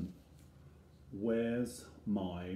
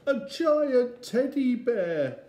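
put-on high, loud childish amazement; big high-pitched wonder; astonished little Eddie; 13 semitones higher, 10 dB louder than usual. A narrator's reading. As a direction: exclaim like an amazed small boy. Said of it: "said Eddie".

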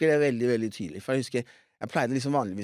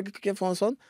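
higher-pitched retold pleading; pleading child; 6 semitones higher than usual. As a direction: insist lightly to dad that it's deserved. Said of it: "Så jeg bare".

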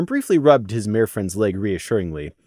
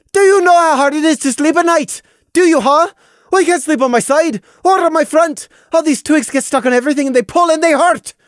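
high, loud, desperate shouting; shrieking complaint to her brother; raging lament; 6 dB louder than usual. A narrator's reading. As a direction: make him hear how it hurts. Sister shouts her suffering.

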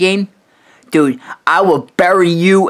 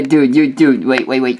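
ordinary delivery

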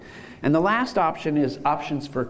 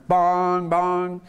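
two slow 'bong's held on one note; level bong tones; 3 semitones higher than usual. Shown as a demonstration. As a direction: imitate a chime repeating the same pitch. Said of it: "supposed to go straight".

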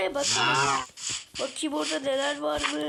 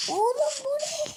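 very high, slow squeak; squeaky high voice; 12 semitones higher, 4 dB louder, slower than usual. Acted. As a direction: ask in a silly high voice. High put-on voice.

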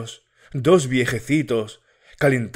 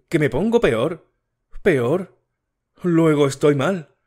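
higher and rising, startled and upset; alarmed; 5 semitones higher than usual. Acted. Matilde alarmed at being told she gets worse. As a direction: catch the fear in the word peor.

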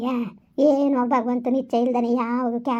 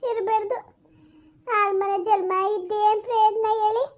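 put-on lamenting old mother; 7 semitones higher than usual. Elder lamenting lost son.

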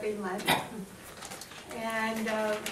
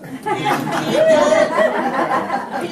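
laughing; 14 semitones higher, 9 dB louder, much slower than usual. Real, not acted. Laughing exaggerated intro.

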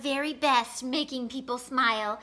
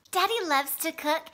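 ordinary delivery

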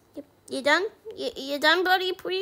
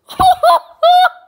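ecstatic high laugh; 10 dB louder than usual. Real, not acted. Overjoyed after lucky pull.

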